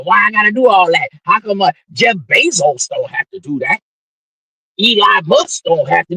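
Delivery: put-on high, whining gripe of a reluctant young man; whiny complaint. Young man whining to the host about effort. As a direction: complain like a kid who won't do the work.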